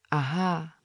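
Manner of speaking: plain reading voice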